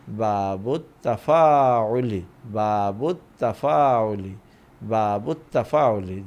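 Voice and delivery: বাবুত তাফাউলি very slowly, with the long vowels stretched; slow, measured repetition of বাবুত তাফাউলি; slow term modelling. Shown as a demonstration. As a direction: say বাবুত তাফাউলি drawn out for learners, falling at the end. Teacher models a new term.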